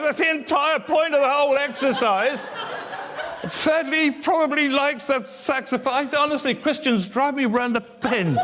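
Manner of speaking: high-pitched comic outburst of exasperation; loud, indignant mock tirade; exasperated rant. Comic outburst at the lady's remark.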